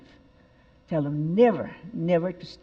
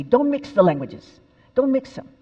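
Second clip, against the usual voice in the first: stern father; 6 semitones higher, 7 dB louder than usual. Retold or imitated. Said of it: "My father used to say".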